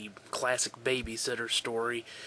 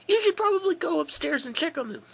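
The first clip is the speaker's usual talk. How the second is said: put-on high girl's voice by mistake; wrong voice; 6 dB louder than usual. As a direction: slip into the babysitter's high voice. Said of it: "oops, wrong fucking person".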